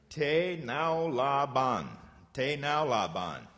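Te nao la ban, each syllable slow and separate; slow teaching demo. Shown as a demonstration. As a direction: spell out Te nao la ban slowly for the kids. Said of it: "Can you say that?".

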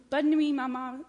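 high French duckling goodnight; baby duck. Acted. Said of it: "said the baby duck".

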